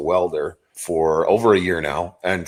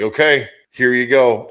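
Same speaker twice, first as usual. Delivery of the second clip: nervous mock handover; 6 semitones higher, 6 dB louder than usual. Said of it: "and you're like".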